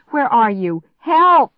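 building to a high, loud cry; desperate call. A narrator's reading. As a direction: call out, then scream for help. Searching house, calling for help.

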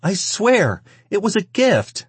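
very high, pleading voice; begging. A narrator's reading. Cowering woman explaining herself to the man, begging. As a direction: swear it desperately. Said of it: "she begged him".

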